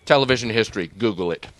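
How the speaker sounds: ordinary delivery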